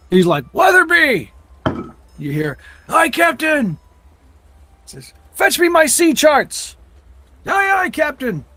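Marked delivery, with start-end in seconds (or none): 0.5-1.3 high, loud shout across the water; captain bellowing a name toward the ship; calling out. Acted. 2.9-3.8 high, distant-sounding reply; calling back. Acted. Sailor answering from the ship. 5.3-8.4 high, slow, loud calls; captain and sailor shouting over the water; shouted orders. Acted. Shouted orders between ship and shore.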